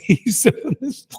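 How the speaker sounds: high, slow laughing aside; amused slow chuckle through the words. Real, not acted. Mimicking drunk ring doctor.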